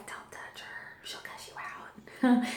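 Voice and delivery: hushed, slow whisper; whispered warning. Retold or imitated. Dad warning aunt off the laboring daughter, whispered. Dad's warning acted as an aside.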